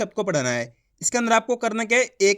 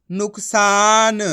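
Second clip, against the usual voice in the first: slow blended word; 5 dB louder, much slower than usual. Shown as a demonstration. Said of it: "ना में छोटू की मात्रा".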